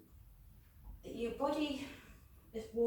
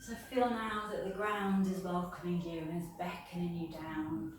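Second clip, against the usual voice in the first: calm yoga nidra guidance with long pauses; guided meditation. Real, not acted. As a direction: ease listeners down into rest, phrase by phrase.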